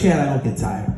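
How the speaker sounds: low, drained mumble of defeat; broken, unconvinced. Retold or imitated. Broken runner obeys his partner at mile seventy-four, unconvinced. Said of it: "But I was so broken, I couldn't say it".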